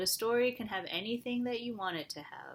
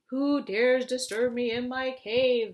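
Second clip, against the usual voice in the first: louder, slow menacing dragon growl; 2 semitones higher, 8 dB louder, slower than usual. Acted. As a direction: rumble it out like an angry dragon in its cave. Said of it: "the dragon growled".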